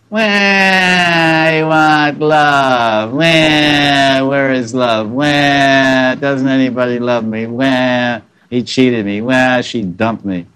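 high, slow, whiny mock baby wail. Preacher mocking lovers crying for love, hammed-up baby wail. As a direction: wail it like a cranky toddler.

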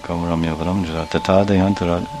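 very low, hushed murmured recitation; soft reading. Acted. Timid Brahmin reading so no one hears mistakes, hushed recitation. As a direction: read under your breath so nobody hears. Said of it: "read the Bhagavad Gita a little softly".

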